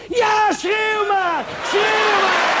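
high, drawn-out mouse shout; triomfantelijke schreeuw. Acted. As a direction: yell it out high, stretching the words. Mouse shouts at the trumpeting elephant. (triomfantelijk uitgerekt geschreeuw)

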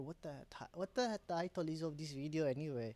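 badly faked Indian accent. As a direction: slip into a clumsy Indian accent. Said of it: "did I just do an Indian accent?".

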